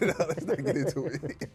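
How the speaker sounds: amused back-pedal through laughter; laughing refusal. Real, not acted. Co-host backs out of the topic, laughing.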